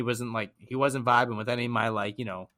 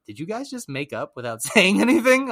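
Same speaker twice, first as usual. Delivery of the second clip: amused question; 8 semitones higher, 6 dB louder than usual. Retold or imitated. Friend's amused question retold.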